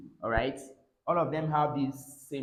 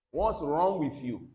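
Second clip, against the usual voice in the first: ordinary talk, nothing marked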